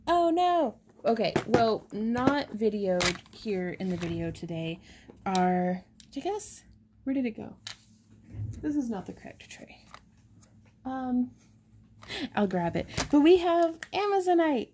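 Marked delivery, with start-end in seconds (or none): 0.1-0.8 high, loud startled cry; dismayed oh no. Real, not acted. Host finding the wrong tray on camera, startled. 13.1-14.7 excited. Real, not acted. Excited reveal of new stone.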